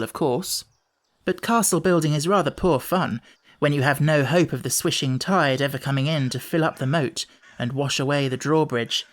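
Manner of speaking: ordinary delivery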